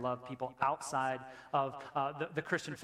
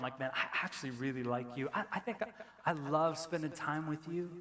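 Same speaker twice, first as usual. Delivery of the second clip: confiding friend; 4 semitones lower, 4 dB quieter than usual. Retold or imitated. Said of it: "you come to me ... and you go".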